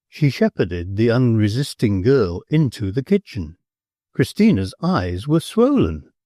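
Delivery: ordinary delivery, nothing marked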